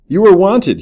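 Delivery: high, loud summons; narrated officer's urgent shout; urgent cry. A narrator's reading. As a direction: call out sharply, pitched well up. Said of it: "he cried".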